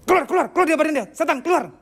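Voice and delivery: high-pitched, urgent mock exorcism; mock exorcist command. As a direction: order the demon out, hammed-up and high. Acted exorcist ordering demon out. (seruan pengusir setan pura-pura)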